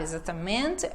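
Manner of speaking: soft, low "exatamente" with no strong syllable; no emphasis. Retold or imitated. Teacher showing a learner's error, soft unstressed "exatamente". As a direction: swallow the end of "exatamente". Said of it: "não dá ênfase, sabe?".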